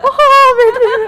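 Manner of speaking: loud, high-pitched imitation through laughter; mock laughing outburst. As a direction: cry out, imitating someone cracking up. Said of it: "and he's doing this". (నవ్వుతూ గట్టిగా అనుకరణ)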